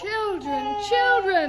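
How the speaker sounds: high, rising mother's call; scolding call. Acted. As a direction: call the squabbling children to order. Mother calling the arguing children to order.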